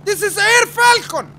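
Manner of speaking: high, loud, slow callsign call; announcer voice. Pretend. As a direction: boom it out like a hero.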